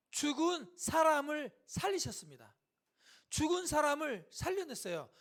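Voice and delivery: high, loud proclamation; emphatic preaching. Real, not acted. Preacher proclaiming the raising of the dead, emphatic. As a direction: drive home each phrase like a preacher.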